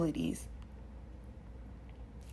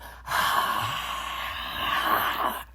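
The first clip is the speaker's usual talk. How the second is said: a hot, heavy breath pushed out; long forceful exhale; 7 semitones lower than usual. Shown as a demonstration. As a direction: blow it all out in one long breath. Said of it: "breathe out the hottest, gnarliest breath".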